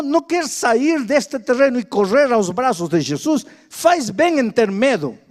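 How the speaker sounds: loud, driving sermon cadence, hammering each phrase; pregação inflamada e forte; preaching at full force. Acted. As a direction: preach it hard, pounding the repeated words. Preacher exhorting the congregation.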